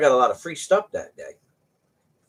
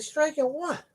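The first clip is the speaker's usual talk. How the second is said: high incredulous question; can't believe what he's hearing; disbelief; slower than usual. Retold or imitated. Trucker asking the dock clerk in disbelief, high and incredulous.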